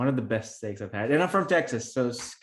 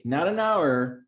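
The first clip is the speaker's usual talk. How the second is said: "not an hour" punched higher, louder and slower; emphatic correction; 5 semitones higher, 4 dB louder, slower than usual. Real, not acted. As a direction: lean hard on "not an hour" to set the record straight. Correcting daily pay, not hourly.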